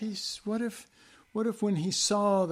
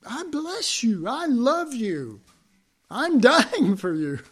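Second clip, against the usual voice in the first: high, drawn-out acted declaration of love; impassioned mock declaration; 8 semitones higher, 7 dB louder, slower than usual. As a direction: plead it high and slow, hammed-up. Acted blessing of those cursing you.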